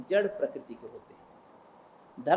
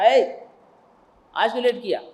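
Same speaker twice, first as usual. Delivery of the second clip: sharp scolding call; 4 semitones higher, 8 dB louder than usual. Acted. Guru scolds late disciple.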